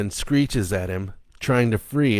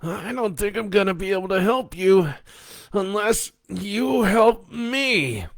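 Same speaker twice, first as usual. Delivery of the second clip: strained plea; 12 semitones higher than usual. Acted. Joe being drained, begging the little girl for help, pained high voice. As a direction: beg for help as the life drains out.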